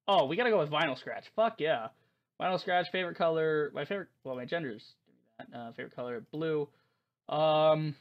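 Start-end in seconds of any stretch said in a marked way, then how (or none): none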